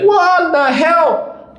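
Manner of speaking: very high, drawn-out mock yell; imitated outraged passing driver. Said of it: "going like".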